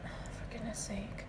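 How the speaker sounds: exasperated whisper. Real, not acted. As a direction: breathe it out under your breath. Exasperated aside.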